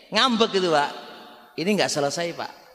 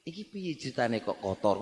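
softer, gentle question in Javanese; polite asking; 3 semitones lower, 9 dB quieter than usual. Shown as a demonstration. Caretaker politely asking why it is dirty, soft and calm. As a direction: ask nicely, keep it cool. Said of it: "bertanya dengan pertanyaan yang bagus".

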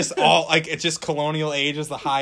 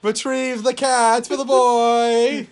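slow, high, loud mock command; hammed-up master giving an order; ordering a servant; 9 semitones higher, 6 dB louder, much slower than usual. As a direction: draw out a grand order.

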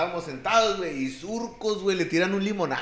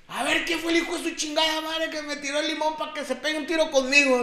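retold challenge; 10 semitones higher than usual. Chore in the middle of the cantina, calling out the culprit, high challenge. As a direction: throw it out to the whole room. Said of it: "se puso en medio de la cantina y dijo".

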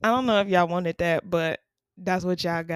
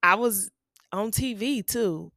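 normal talking voice

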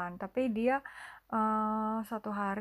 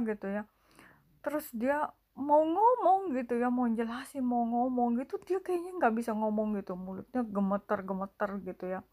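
normal talking voice

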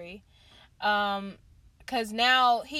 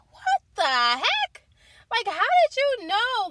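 much higher, louder disbelieving cry; shocked reaction; 11 semitones higher, 6 dB louder, slower than usual. Retold or imitated. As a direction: gasp it out in disbelief at the king. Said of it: "And I'm just like".

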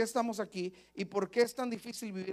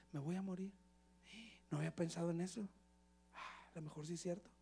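very soft, hushed thinking aloud; worried self-talk; 4 semitones lower, 15 dB quieter, faster than usual. Acted. Believer hears the enemy's threat, hushed worried self-talk. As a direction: murmur it to yourself, sinking into doubt.

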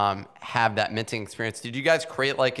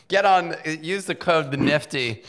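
laughing; 5 semitones higher, 3 dB louder than usual. Real, not acted. Joking plug, laughs.

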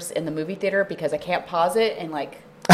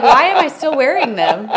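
laughing; 8 semitones higher, 8 dB louder than usual. Retold or imitated. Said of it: "I was like".

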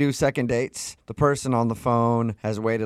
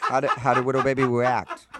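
laughing. Real, not acted.

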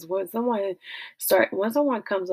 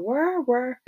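angry prayer; 5 semitones higher than usual. Acted. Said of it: "My Saint Louis coming out".

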